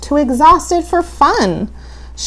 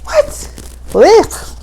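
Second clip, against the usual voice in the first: grossed-out surprise; 9 semitones higher than usual. Acted. Reacting to fish eyeball jelly.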